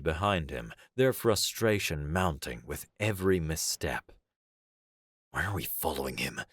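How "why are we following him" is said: grumbling. A narrator's reading. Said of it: "one of them grumbled".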